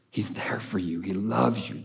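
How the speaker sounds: a hushed, reassuring whisper to the listener; tender whisper. Real, not acted. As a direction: breathe it like a secret comfort.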